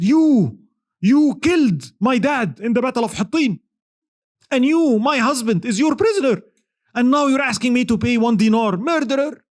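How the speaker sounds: high, accusing and grieving; a wife whose husband is prisoner accuses him, high and bitter; angry widow. Retold or imitated. Woman accuses Salah ad-Din.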